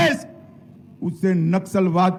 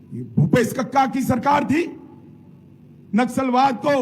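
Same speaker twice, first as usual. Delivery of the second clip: ordinary talk, nothing marked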